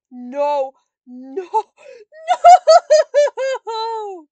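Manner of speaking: loud no that breaks into a high, wailing scream; screamed despair. Real, not acted. Player losing to the game, screaming in despair. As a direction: build to a huge, high shuddering wail.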